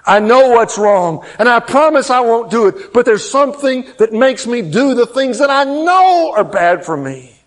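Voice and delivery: high, loud preaching climax; full-force preaching. Acted. Pastor preaching to his congregation, full force. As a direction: preach it out to the back row.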